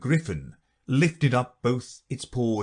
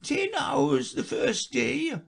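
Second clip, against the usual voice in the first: high, slow, weepy listing; a sad creature counting on flappers; high slow lament; tearful Mock Turtle; much slower than usual.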